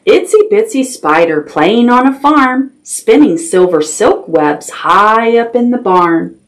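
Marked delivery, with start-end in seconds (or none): none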